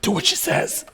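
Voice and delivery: loud exasperated order. Retold or imitated. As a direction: snap at the kids, fed up. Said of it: "And I'm like".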